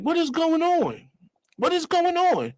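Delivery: high, drawn-out mock bewilderment; baffled inner voice. Passenger to himself in back seat, high baffled whine. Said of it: "what I'm saying to myself".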